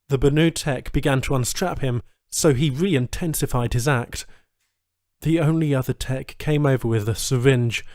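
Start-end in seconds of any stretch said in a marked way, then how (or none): none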